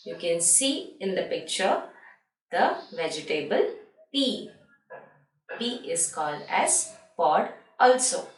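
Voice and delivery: ordinary talk, nothing marked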